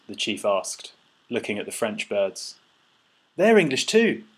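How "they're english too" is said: high, loud insistence; insistent. A narrator's reading. Paddy vouching for his French companions, loudly insistent. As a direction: insist on it, pitched right up. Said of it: "Paddy insisted".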